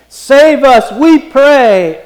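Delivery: urgent, loud stress on every word; emphatic plea. Shown as a demonstration. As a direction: SAVE US and WE PRAY punched high and loud.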